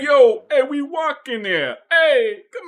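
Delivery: high, loud hammed-up New York yell; New York accent. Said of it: "that one's supposed to be New York".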